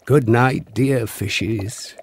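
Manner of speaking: plain reading voice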